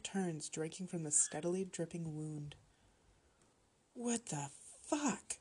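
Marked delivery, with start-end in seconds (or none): 3.9-5.4 held-back mock shout, slow and high; shocked shout. A narrator's reading. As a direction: cry it out high without volume. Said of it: "I shouted".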